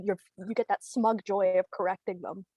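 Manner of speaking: usual speaking voice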